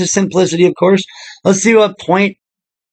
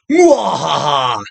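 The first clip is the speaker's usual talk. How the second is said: evil laugh; 4 semitones lower, slower than usual. Pretend. Villain laugh after vast fortune.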